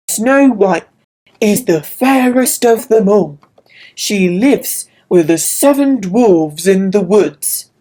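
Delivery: magic mirror. Acted. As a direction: deliver it like an oracle. Magic mirror answers queen.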